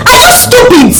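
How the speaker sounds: high, incredulous outburst; angry insult. Acted. Insulting rival in a quarrel, angry. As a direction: throw it out in outraged disbelief.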